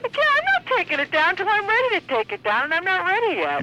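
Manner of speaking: high-pitched, defiant protest; real indignation; indignant refusal. Real, not acted. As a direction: dig in stubbornly against an official. Refuses the fake officer.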